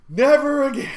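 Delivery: high, loud joking protest; mock outrage. Shaken passenger storming into the store, mock outrage. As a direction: cry it out in mock horror.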